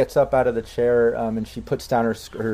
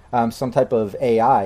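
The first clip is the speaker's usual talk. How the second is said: usual speaking voice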